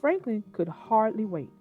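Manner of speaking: ordinary delivery, nothing marked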